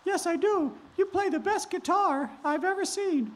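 high sweet princess voice; high girlish Cinderella. Acted. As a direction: greet him in a high girlish voice. Cinderella praises the wolf.

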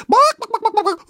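loud, very high clucking; upset chicken squawk. Acted. Storyteller voicing upset morning chicken, frantic squawk. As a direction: cluck like a distressed hen. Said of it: "everyone was so upset".

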